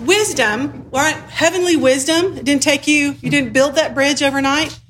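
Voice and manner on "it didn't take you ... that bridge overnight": ordinary talk, nothing marked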